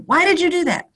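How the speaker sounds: tender, high questioning voice; gentle question. Retold or imitated. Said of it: "I look at him and I said".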